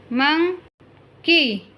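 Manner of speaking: very slow, stretched monkey; slow careful model word. Shown as a demonstration. Teacher modelling monkey slowly for listening pupils. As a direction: draw out monkey for the class. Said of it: "listen to your teacher say the following words".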